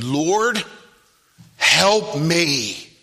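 acted-out desperate plea; pleading. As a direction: beg for help, drawn out. Voicing woman pleading to Jesus.

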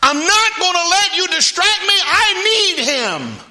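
high, loud, insistent yell. Acted. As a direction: shout defiantly over those hushing you. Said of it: "he just yelled it out louder".

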